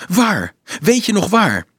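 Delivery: high, loud, pressing questions; agitated demand; urgent questioning. A narrator's reading. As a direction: press for the answer, pitch shooting up. Urgent questioning about the find.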